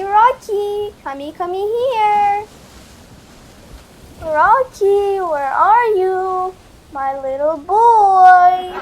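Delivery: loud, drawn-out calls; slow, loud calls after the runaway puppy; calling out. Acted. Calling runaway dog loudly.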